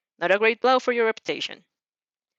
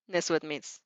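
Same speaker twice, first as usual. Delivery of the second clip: usual speaking voice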